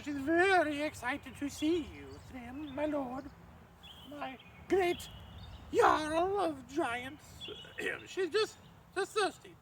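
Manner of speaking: high simpering maid's voice; falsetto maid. Acted. Loki in disguise presents the bride to Thrym in falsetto. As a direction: keep the high fawning disguise voice.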